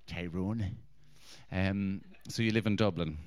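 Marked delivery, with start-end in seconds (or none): 0.1-0.8 low, soft mock Northern Irish; Tyrone accent. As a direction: imitate the local accent, low as a tiger. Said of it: "They say Tyrone like a fucking tiger".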